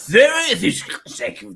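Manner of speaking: hammed-up DJ, words drawn right out with swooping pitch; enthusiastic DJ voice. Comedian mocking the jingle firm's voiceover artist, hammed-up DJ voice. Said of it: "very enthusiastic D J voice".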